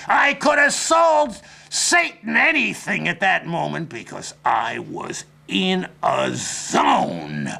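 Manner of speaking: normal talking voice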